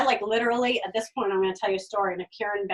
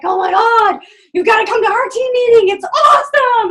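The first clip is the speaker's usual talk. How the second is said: higher and much louder, enthusiastic pitch; gushing excitement; 10 semitones higher, 13 dB louder than usual. Retold or imitated. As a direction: sell the meeting like it's the best thing ever. Team members gush about meeting.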